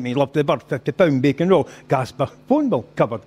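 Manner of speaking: ordinary delivery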